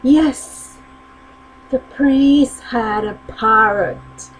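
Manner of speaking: normal talking voice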